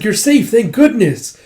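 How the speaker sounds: high, slow acted relief; relieved cry. Follower seeing the captain safe, high relieved cry. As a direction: gush with drawn-out relief.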